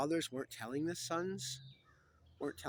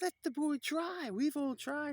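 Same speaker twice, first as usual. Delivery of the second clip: high, loud, slow put-on knight voice; jeering knights; 7 dB louder, slower than usual. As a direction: crow it out for the crowd.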